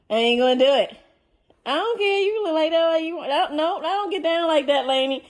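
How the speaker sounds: mock-stern mama refusing kisses; loud scolding. Real, not acted. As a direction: shut the dog down firmly and loud. Scolding dog licking her.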